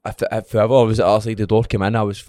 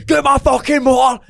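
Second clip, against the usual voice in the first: angry yell; 13 semitones higher, 4 dB louder, slower than usual. Retold or imitated. Retold row over his car.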